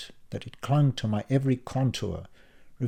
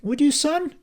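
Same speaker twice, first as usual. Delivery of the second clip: fatherly defence; 13 semitones higher, 6 dB louder than usual. A narrator's reading. Dad defending son.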